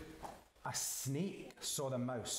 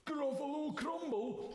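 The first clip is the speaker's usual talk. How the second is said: "Gruffalo Crumble" high and quicker, in alarm; horrified Gruffalo; 11 semitones higher than usual. Acted. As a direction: yelp "Gruffalo Crumble" in horror.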